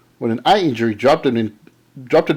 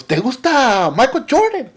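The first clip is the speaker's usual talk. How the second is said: quoting the seller in Spanish; 9 semitones higher than usual. Retold or imitated. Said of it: "He goes".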